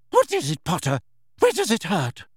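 put-on high, sharp, concerned older woman; brisk worried teacher; concerned professor. A narrator's reading. McGonagall rushing to a sick pupil, concerned.

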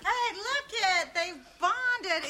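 high, slow, gushing "look it"; excited. Acted. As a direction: coo it like proud delight. Mock delight at the two men.

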